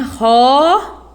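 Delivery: rosa with a clear Z sound. Shown as a demonstration. Teacher presenting rosa to show the Z sound. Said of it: "Olha o som".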